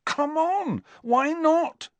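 Harry pushing Hermione, demanding. A narrator's reading. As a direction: press hard, pitching up. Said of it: "demanded Harry".

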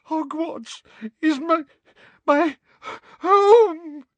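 very high, slow, stammering sobs; tearful pleading. Acted. Sacked seer begging to stay, high, slow and tearful. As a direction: beg through tears, breaking on each word. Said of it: "tears streaming down her face".

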